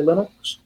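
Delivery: "Linux" said LIN-ux; first syllable of "Linux" rhymes with pin; short-i Linux. Shown as a demonstration. Guest allowing the third way, short-i "Linux". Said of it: "no particular reason you can't say".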